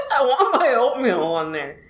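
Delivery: high, laughing pretend whine; fake-cry over a petty disappointment; playful tearful plea through a laugh; mock crying. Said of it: "I'm gonna cry".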